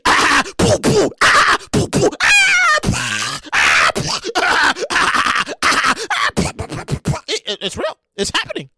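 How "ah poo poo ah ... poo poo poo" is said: mock laser blasts. Imitating laser gun battle.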